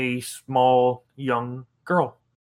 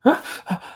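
terrified gasp; 10 semitones higher than usual. Acted. DM voices a frightened gasp.

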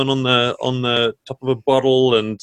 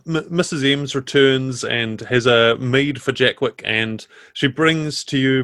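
usual speaking voice

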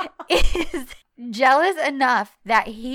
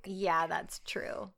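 whispered agreement; 2 semitones lower, 11 dB quieter, much slower than usual. Real, not acted. Quiet agreement.

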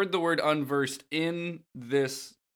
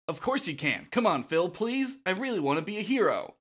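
put-on pleading young hero, pitched up; Zack; 6 semitones higher than usual. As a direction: beg the trainer eagerly. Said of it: "Zack says".

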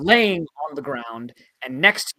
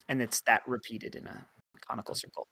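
ordinary talk

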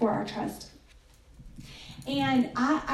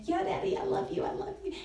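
put-on higher, light child's voice, sweet and eager; a daughter's quick, high I love you; little girl voice; 8 semitones higher, 5 dB quieter, faster than usual. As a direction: play the small daughter answering her dad. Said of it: "And she said".

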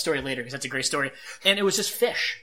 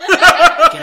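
laughing shout; 12 semitones higher, 16 dB louder than usual. Retold or imitated. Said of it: "And I'm like".

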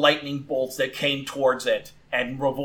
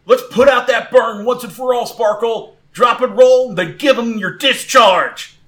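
sustained high, loud battle orders; calling out; 8 semitones higher, 7 dB louder than usual. Acted. Trainer gives Sparkle new orders, calling out loud. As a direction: shout instructions to your fighter. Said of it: "Ethan calls out to it again".

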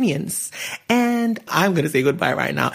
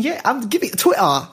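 Twitter said in a higher, put-on British accent; 6 semitones higher than usual. Shown as a demonstration. Host teases listeners with a British-accented Twitter. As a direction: give Twitter a British twist, playfully. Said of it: "I know I sound British with that one".

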